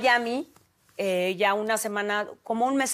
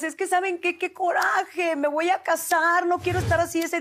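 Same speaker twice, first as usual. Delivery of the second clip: exasperated complaint; 7 semitones higher than usual. Retold or imitated. Retold complaint to friends.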